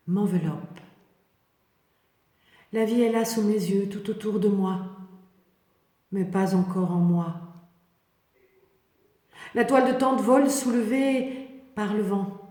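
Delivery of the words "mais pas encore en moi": usual speaking voice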